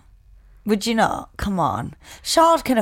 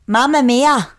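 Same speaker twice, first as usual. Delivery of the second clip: mock Scouse "Mamma mia", louder and higher; Scouse accent; 4 semitones higher, 5 dB louder, slower than usual. Co-host doing a Scouse mamma mia for the joke, loud and high. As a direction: say "Mamma mia" like a Scouser telling their mam they're home. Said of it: "I imagine a Scouser".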